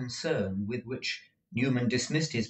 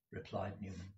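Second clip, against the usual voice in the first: plain reading voice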